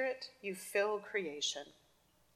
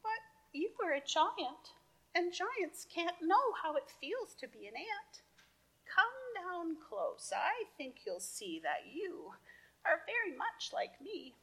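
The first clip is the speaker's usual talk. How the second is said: high, gentle pleading ant; tiny ant voice; 9 semitones higher, 3 dB quieter than usual. Acted. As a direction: coax softly, high and small. Ant answers the kid.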